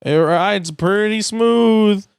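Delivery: proud Mater over bumpy tar; Mater on bumpy road. Acted. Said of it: "Drives on the road and vibrates".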